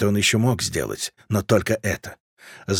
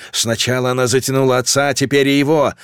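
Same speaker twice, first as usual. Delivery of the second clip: plain reading voice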